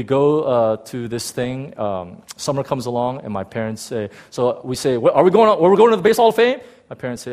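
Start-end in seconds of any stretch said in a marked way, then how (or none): 5.0-6.6 high, loud, rushed question; tumbling over the words with excitement; eager kid asking. Retold or imitated. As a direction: burst out with excited, impatient hope. Children asking about promised trip.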